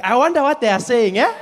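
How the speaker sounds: high, curious South African voice; puzzled viewer with a South African lilt; South African accent. Retold or imitated. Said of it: "we watch those movies and we're like".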